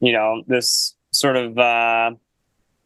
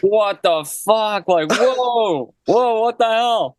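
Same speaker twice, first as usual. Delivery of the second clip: high, drawn-out mock disbelief; imitating the band's stunned reaction; shocked outrage; 9 semitones higher, slower than usual. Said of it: "we were just like".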